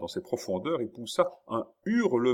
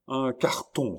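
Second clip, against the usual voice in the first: raised, excited announcement; exclaiming; 6 semitones higher, 4 dB louder, slower than usual. A narrator's reading. Chon at the window, exclaiming. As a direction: call out the discovery. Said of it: "s'écria-t-elle".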